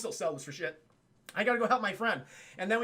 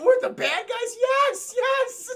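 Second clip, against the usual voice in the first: high loud disbelief; over-the-top shocked yelling; mock shocked shout; 9 dB louder, slower than usual. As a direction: yell it in hammed-up surprise. Zangief shocked exchange.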